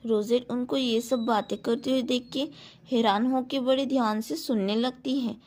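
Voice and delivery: usual speaking voice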